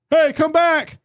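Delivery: high-pitched call to a distant dog. Acted. Owner to dog a mile away, calling out. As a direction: holler for the dog to come back.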